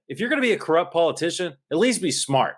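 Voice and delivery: normal talking voice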